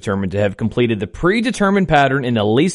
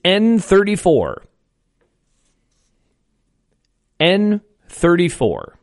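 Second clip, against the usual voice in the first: slow, drawn-out number calling; bingo caller; 3 semitones higher, slower than usual. Acted. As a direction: call each number slow and clear.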